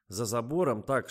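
plain reading voice

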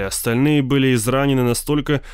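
ordinary delivery, nothing marked

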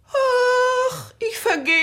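high, crying wail; theatrical weeping cry; crying lament. Acted. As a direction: she is coming apart with grief. Widow lamenting dead husband.

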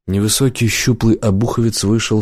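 plain reading voice

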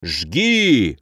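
understated fierce battle cry; drawn-out rallying cry, not fully shouted. A narrator's reading. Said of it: "прокатился яростный крик". (сдержанно-яростный боевой клич)

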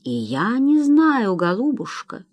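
slow, high swell falling away; helpless admission. A narrator's reading. Doctor answering the frantic wife, helpless. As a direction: draw it out, at a loss.

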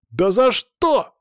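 high, loud, drawn-out outrage; indignant protest. A narrator's reading. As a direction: protest as if wronged. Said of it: "тут же возмутился Саня". (возмущённо, громко и высоко)

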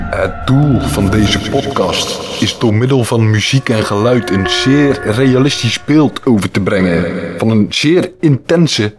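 usual speaking voice

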